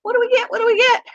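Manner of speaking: high, squeaky begging voice; voicing the guinea pigs. Owner voicing her guinea pigs begging for food. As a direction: beg for treats like a tiny pet. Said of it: "they're both up there like".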